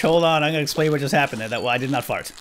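normal talking voice

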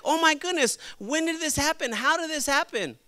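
high-pitched disbelief; mock shock. Preacher imitates people reacting to bad news, mock shocked. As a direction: play a scandalized bystander. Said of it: "we're, like, shocked".

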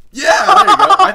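high, loud laughing cheer. Real, not acted. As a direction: cheer through real laughter. Laughing at teammate's win.

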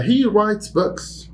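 high, slow, "he" stressed; high model sentence. Shown as a demonstration. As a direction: model it brightly for learners. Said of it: "teacher demo".

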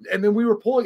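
normal talking voice

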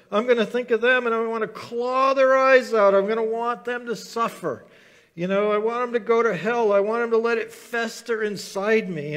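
loud, high, seething mock complaint to God; bitter rant. Preacher acting out a bitter believer ranting at God, loud and seething. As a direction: vent it like a resentful person stewing for years. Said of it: "just choose to be bitter all you want".

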